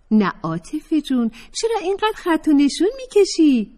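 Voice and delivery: Fatemeh's voice. Acted. Fatemeh chiding Atefeh, high and playful. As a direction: chide her friend in a high voice.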